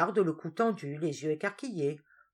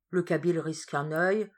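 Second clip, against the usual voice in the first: usual speaking voice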